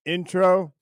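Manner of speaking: ordinary delivery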